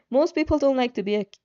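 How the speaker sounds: ordinary delivery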